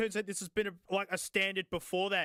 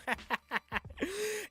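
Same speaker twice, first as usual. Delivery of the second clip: a burst of high, breathy giggling; high giggle; 12 semitones higher than usual. Real, not acted. Vlogger giggling at the ugly case, high-pitched burst of laughter.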